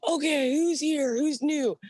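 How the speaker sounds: high, slow drill-sergeant call; gunnery sergeant calling out. Retold or imitated. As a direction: bark out the roll call like a sergeant.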